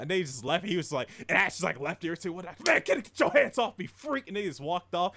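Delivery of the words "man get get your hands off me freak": high, sharp acted protest; irritated recoil. Gang leader pulls away from mafia boss, irritated. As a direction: shake him off in disgust. Ash rejects Dino's touch.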